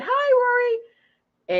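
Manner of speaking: high-pitched sing-out hello; teacher's greeting. Retold or imitated. Old teacher greeting Rory at pickup, high and friendly.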